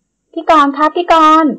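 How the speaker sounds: high, loud, slow drawn-out call; calling out loudly. A narrator's reading. Nan arrives and calls loudly for Korn outside. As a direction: call across the house to get his attention. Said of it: "ตะโกนเรียกอีกฝ่ายเสียงดัง".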